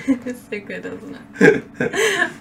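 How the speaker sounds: laughing. Real, not acted. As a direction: talk through the giggles. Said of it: "I knew it".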